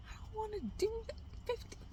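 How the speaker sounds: high, fast whining; whiny self. Retold or imitated. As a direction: whine it like a sulky kid. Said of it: "And I'm like".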